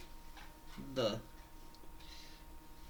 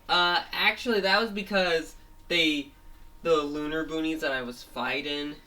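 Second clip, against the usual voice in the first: villain voice; 4 semitones higher, 6 dB louder than usual. Retold or imitated. Villain voiced explaining to boss.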